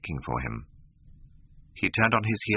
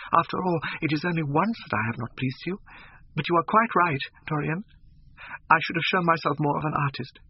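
put-on much higher, desperate pleading girl's voice; pleading young actress; 11 semitones higher than usual. A narrator's reading. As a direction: beg him to stay, voice pitched high and fragile. Sybil's pleading voice in story.

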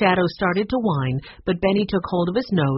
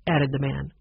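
ordinary delivery

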